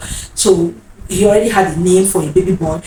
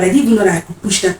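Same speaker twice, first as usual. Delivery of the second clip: usual speaking voice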